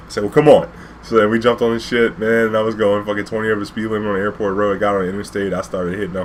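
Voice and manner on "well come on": urgent call. Retold or imitated. Driver urging client to hurry.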